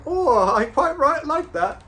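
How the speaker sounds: very high mock Liverpool accent; put-on Liverpudlian impression. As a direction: exclaim in an exaggerated Scouse voice, way up high. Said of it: "John Lennon, he's like".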